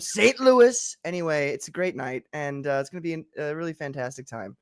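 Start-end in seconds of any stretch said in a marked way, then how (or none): 0.0-1.0 high, punchy presidential mimicry of "Saint Louis"; quick showy political impersonation; Trump. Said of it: "I said that like Trump".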